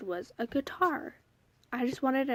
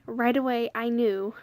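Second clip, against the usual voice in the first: ordinary talk, nothing marked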